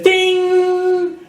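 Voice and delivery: a high, sharp ding; mock sting sound.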